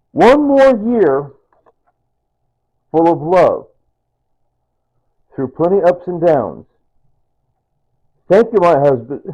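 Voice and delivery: loud, slow declaimed verse; measured, projecting recital; card read aloud. Retold or imitated. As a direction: read it out slowly with big pauses. Reads his late wife's card aloud.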